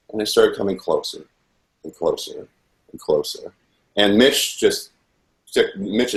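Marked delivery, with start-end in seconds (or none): none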